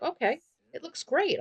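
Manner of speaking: lightly voiced boyfriend, pleased. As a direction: boyfriend accepting the sushi, high and eager. Boyfriend voiced in Sims story.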